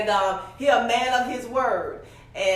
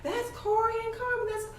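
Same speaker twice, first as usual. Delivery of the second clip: much higher, thrilled squeal of recognition; excited kid; 13 semitones higher, 3 dB quieter than usual. Retold or imitated. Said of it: "my daughter's friends was like".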